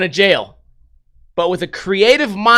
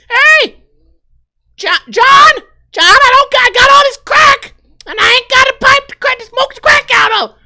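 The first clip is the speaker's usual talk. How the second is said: high, loud screeching woman demanding a crack pipe; shrill crackhead woman; 7 dB louder than usual. Acted. Girlfriend yelling at boyfriend for a pipe, high screeching. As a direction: screech it like a desperate addict girlfriend. Voicing Tammy begging for pipe.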